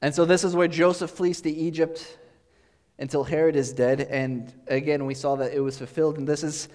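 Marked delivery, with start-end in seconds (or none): none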